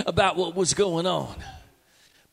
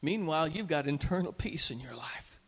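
hushed, calm whisper; 3 semitones lower, 6 dB quieter than usual. Acted. Preacher contrasts panic with inner peace, whispering. Sudden hush after panic.

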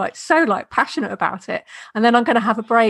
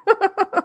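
light laugh; 9 semitones higher than usual. Real, not acted. Laughs at her own joke.

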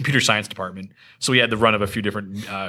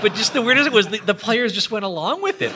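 amused disbelief; 12 semitones higher than usual. Real, not acted. Incredulous retelling of party's choice.

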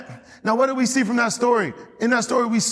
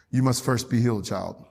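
put-on lower, softer voice, sinking on "child"; mock solemn Jesus; 8 semitones lower, 5 dB quieter than usual. Jesus answering the leper who asks for cleansing, mock solemn. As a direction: imitate a grave, hushed pronouncement. Invented reply of Jesus, crowd laughs.

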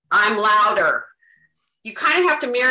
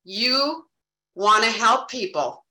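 usual speaking voice

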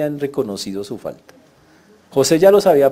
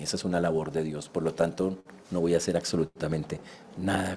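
soft, lower, tender words of forgiveness; calm the frightened brothers quietly; hushed sincere comfort; gentle reassurance; 7 semitones lower, 6 dB quieter than usual. Retold or imitated. Joseph reassures brothers again.

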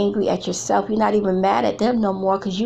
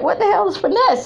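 baffled laugh; 11 semitones higher than usual. Retold or imitated. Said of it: "And I was like".

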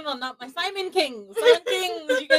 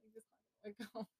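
gentle whisper; 3 semitones lower, 23 dB quieter, much faster than usual. Shown as a demonstration. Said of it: "I'll say that gently".

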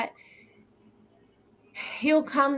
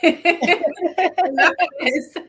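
slow, much higher and louder, through laughter; laughing; 8 semitones higher, 8 dB louder, much slower than usual. Real, not acted. Cutting off her co-host's closing line, laughing loudly. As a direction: laugh it out in mock protest.

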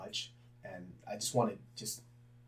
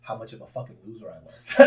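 ordinary talk, nothing marked

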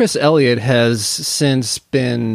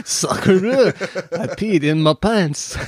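put-on French accent; 6 semitones higher, slower than usual. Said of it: "repeats the Victor Hugo line".

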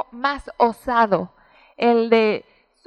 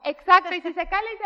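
ordinary delivery

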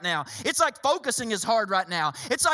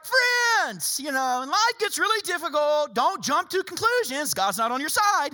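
high, airy flippant reading of Scripture; 4 semitones higher than usual. Acted. Preacher mimicking Peter's letter to his church, flippant. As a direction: breeze it off high, as if nothing is wrong.

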